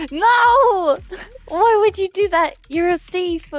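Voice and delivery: usual speaking voice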